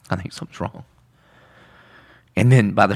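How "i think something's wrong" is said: softer, a touch of dread; quiet worried repeat. Retold or imitated. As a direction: let the worry sink in, quietly. Repeated, fading worry.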